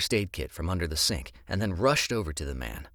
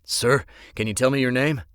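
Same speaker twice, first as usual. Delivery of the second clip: urgent question; 7 semitones higher, 8 dB louder than usual. Acted. Urgent question to wounded man.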